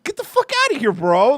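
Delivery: high and loud scoff; scoffing dismissal. Retold or imitated. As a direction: wave it off as ridiculous. Retold dismissal of absurd claim.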